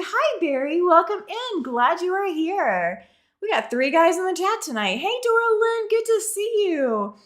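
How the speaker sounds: bright high welcome; cheery greeting. Real, not acted. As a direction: greet them warmly and excitedly. Greeting chat viewers.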